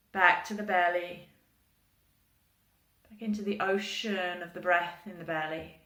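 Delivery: unhurried meditation voice with long pauses; guided meditation. Real, not acted. Yoga teacher leading an ocean-breath visualization, guided meditation. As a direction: guide them gently through the breath, phrase by phrase.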